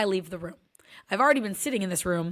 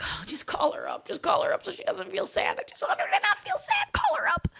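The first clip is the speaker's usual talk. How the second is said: pleading, rising far higher into a squeak; pleading mom. Retold or imitated. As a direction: beg under your breath, climbing higher. Mom pleading silently for daughter.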